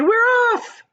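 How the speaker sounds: very high, loud cheer; excited cry. A narrator's reading. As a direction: shout for joy together. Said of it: "the others cried".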